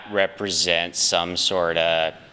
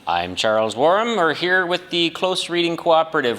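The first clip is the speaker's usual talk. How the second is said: put-on higher, lilting Canadian accent; Canadian dialect pitched up; 10 semitones higher, 3 dB louder than usual. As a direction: lay on an old-home Canadian accent. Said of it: "your voice sounds different today".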